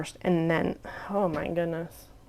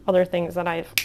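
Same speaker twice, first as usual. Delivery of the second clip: ordinary talk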